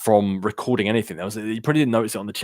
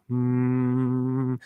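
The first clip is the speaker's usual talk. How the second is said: long steady droning hum; imitated background hum; 2 semitones higher than usual. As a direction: hum like constant mic background noise. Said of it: "you kept hearing, like, this".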